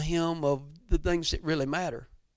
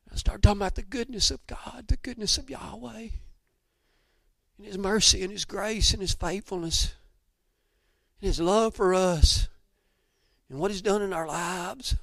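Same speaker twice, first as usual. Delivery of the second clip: higher, emotional testimony, voice softening at times; choked up; 6 semitones higher, 3 dB quieter than usual. Real, not acted. Preacher recalling sharing the goodness of God, choked up. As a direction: let emotion lift and thin the voice. Moved retelling tearful testimony of faith.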